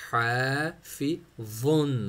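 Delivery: slow हाफिज़ुन with a heavy हा and a soft ज़. Shown as a demonstration. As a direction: stretch हाफिज़ुन out slowly. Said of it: "मोटा and heavy".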